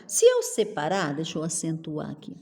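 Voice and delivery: ordinary talk, nothing marked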